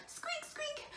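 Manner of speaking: tiny high squeaking; mouse squeaks. A narrator's reading. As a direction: squeak softly like a little mouse. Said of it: "The little mouse looks back".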